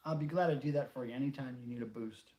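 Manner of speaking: ordinary talk, nothing marked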